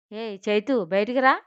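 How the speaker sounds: loud call to her sister; shouting. Acted. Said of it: "అని అరిచింది మేఘ".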